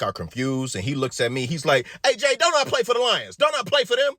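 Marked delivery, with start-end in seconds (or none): 2.1-4.1 high, loud demanding question; pleading patient. Retold or imitated. Patient asks Jay to confirm, high insistent plea. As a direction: make him back you up.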